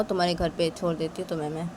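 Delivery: ordinary delivery, nothing marked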